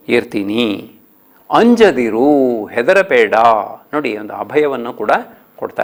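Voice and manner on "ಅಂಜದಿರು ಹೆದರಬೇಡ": very slow verse reading; poem recitation. Shown as a demonstration. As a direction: stretch ಅಂಜದಿರು out. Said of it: "ಹದಿನಾರನೇ ಪದ್ಯ". (ನಿಧಾನ ಪದ್ಯ ವಾಚನ)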